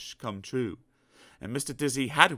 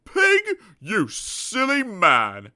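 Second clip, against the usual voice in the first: very high then slow loud scoffing; trumpeting scorn; 10 semitones higher, 8 dB louder, much slower than usual. Acted. Elephant scorning Mister Dizzy, trumpeting loudly. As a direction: blast it like an elephant. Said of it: "he trumpeted".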